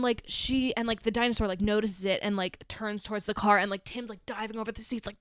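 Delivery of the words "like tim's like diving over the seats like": ordinary delivery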